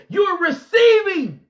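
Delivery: loud, slow, high cry of receiving; preaching shout. Real, not acted. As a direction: lift it up and shout it out slowly.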